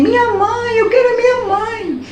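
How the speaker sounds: re-enacted shrill plea of a small boy; child's cry. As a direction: imitate a child wailing for mum. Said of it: "gritava assim".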